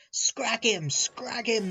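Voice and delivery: high-pitched storybook yell. A narrator's reading. Loaded trucks out to pay an engine out, yelling. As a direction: yell it as a baying gang. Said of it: "they yelled".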